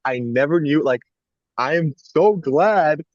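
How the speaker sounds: ordinary talk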